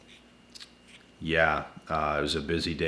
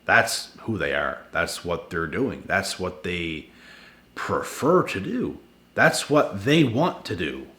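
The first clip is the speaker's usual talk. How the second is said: normal talking voice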